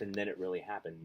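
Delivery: ordinary delivery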